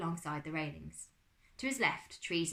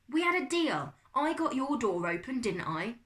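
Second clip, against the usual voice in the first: loud, high-pitched protest; indignant acted squabble; arguing; 7 semitones higher, 7 dB louder than usual. A narrator's reading. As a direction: argue your side at raised volume. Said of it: "cousins arguing".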